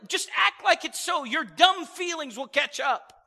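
ordinary talk, nothing marked

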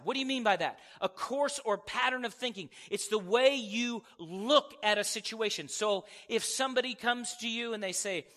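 ordinary talk

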